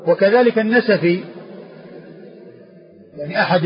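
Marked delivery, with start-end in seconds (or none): none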